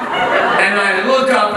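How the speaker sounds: usual speaking voice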